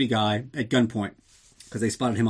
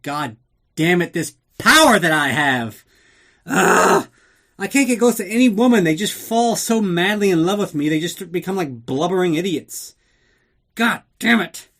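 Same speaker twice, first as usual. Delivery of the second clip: much higher, louder hammed-up lament; mock anguished Bond; 7 semitones higher, 7 dB louder than usual. Bond cursing the power he has over women, hammed-up loud lament. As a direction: ham it up like a tortured heartthrob.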